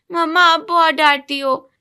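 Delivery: put-on a kid sulking at his mom; complaining child. Son complains about scolding. (शिकायत करता छोटा बच्चा, ऊँची आवाज़ में)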